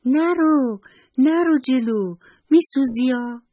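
high, loud cry of warning; warning shout. Acted. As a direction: shout to stop the butterfly. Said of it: "موشی داد زد". (فریاد هشدار با صدای بلند و زیر)

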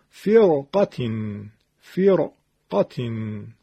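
slow recitation of فرقتین with thick R; heavy R. Shown as a demonstration. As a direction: model فرقتین slowly, the R kept heavy. (فرقتین شمرده و با تفخیم راء)

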